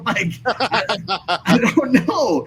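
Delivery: laughing through the words. Real, not acted. Podcast host laughing at his own rant. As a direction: break into a laugh mid-sentence.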